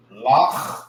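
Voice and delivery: "Lach" with a long throaty kh; syllable demo. Shown as a demonstration. Teacher breaking the word down, isolated syllable. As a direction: rasp out the end of "Lach", rising. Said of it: "you have the".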